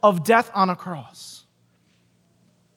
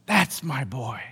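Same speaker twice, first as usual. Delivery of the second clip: low, soft repeat, a touch quicker; fond fatherly echo; 9 semitones lower, 8 dB quieter, slower than usual. Retold or imitated. Preacher repeating God's pride in Jesus, soft and low. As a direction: say it again, quietly savouring it. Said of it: "he looks at him and goes".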